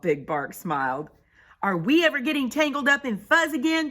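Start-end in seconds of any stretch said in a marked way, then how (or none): 1.6-3.9 high, loud, fast question; rallying cry. Acted. Pipsqueak rallying the crowd, crying out. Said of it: "cried Pipsqueak".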